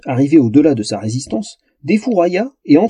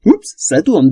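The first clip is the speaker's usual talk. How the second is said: higher, tipsy little-elf voice; babbling elf; 5 semitones higher, slower than usual. A narrator's reading. Drunk elf stood up too fast, babbling. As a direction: babble it girlishly, a touch dizzy. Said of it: "babilla l'elfe".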